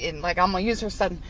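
ordinary talk

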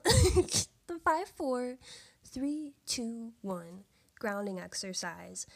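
very slow, words stretched apart. Shown as a demonstration. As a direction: draw out each number deliberately. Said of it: "I have to really slow myself down when I say that".

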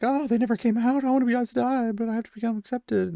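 high, squeaky mock panic; mock panicked Egwene. Recapper mimics a scared Egwene, high and panicky. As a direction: whine it like a terrified young novice. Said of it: "her being like".

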